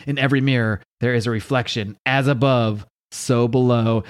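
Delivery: ordinary delivery, nothing marked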